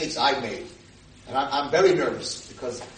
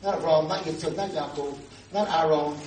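ordinary delivery

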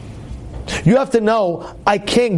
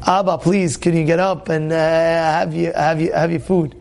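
polite, lower, hesitant request; respectful Yaakov manner; 4 semitones lower than usual. Acted. Yaakov asking his father to get up, respectful. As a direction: ask father gently, feeling out the words. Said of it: "Yaakov would say".

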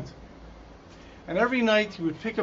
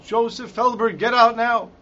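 raised, higher commanding shout; screamed order; 4 semitones higher, 6 dB louder than usual. Retold or imitated. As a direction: call the prisoner out with a loud shout. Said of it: "the Nazi commander screams out".

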